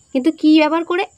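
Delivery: usual speaking voice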